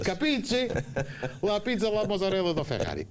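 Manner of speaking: hammed-up Italian accent, laughing; mock Italian accent. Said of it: "falar com sotaque italiano".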